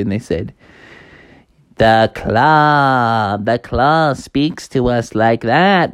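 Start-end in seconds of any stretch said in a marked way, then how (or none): 1.8-5.9 slow, awestruck little alien voice; slow worshipful chant; Toy Story Martians. Acted. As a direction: draw it out in reverence like a toy alien. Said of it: "those green Martians from Toy Story".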